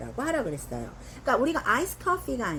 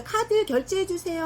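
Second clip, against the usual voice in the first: pitched up high as a customer; higher pretend request; 9 semitones higher than usual. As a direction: ask the cashier in a raised voice. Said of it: "이렇게".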